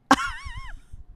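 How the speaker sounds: a short squeaky giggle; high embarrassed laugh. Real, not acted. Vlogger laughing at her own accent apology, high giggle.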